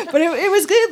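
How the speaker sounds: high, loud laughing start. Real, not acted. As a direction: talk on through the tail of a laugh. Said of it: "Sorry, I shouldn't laugh".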